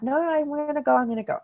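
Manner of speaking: put-on high, eager little girl insisting; mock little girl digging in her heels; insistent child. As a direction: play the stubborn kid who won't be talked out of it. Daughter insisting, retold.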